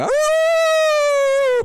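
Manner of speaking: very high, loud imitated howl; the dogs in the audience howling loud and high; coyote howl. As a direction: howl like a dog hit by a mic squeal. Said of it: "Like coyotes".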